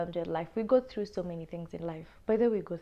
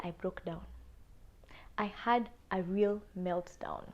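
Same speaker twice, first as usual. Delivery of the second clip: soft unvoiced whisper; hushed whisper of the meltdown; whispered confession; 2 semitones lower, 9 dB quieter than usual. Real, not acted. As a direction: whisper it like a shameful secret. Confessing breakdown to viewers.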